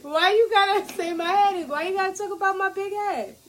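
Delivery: high, whiny protest at teasing; mock-offended. Co-host teased about a big head, mock-offended. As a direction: complain as if hurt by the joke.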